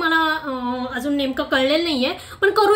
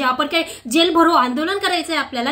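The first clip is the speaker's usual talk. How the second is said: ordinary delivery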